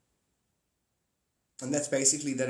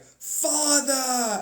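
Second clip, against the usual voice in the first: dramatic shout; 12 semitones higher, 7 dB louder than usual. Retold or imitated. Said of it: "he's like".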